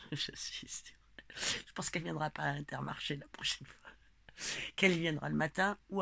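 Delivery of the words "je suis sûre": laughing whisper. Real, not acted. Gloating aside after laughing.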